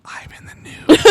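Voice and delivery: breathy whisper. Shown as a demonstration. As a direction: whisper it into an ear. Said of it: "Just right in your ear".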